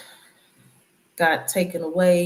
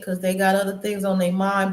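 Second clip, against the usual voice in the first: usual speaking voice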